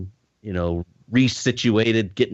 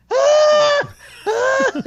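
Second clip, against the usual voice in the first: loud high strained yelps climbing out of foam; struggling yelps; 5 dB louder than usual. Retold or imitated. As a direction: strain and yelp like you are stuck in quicksand. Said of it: "struggling to get out".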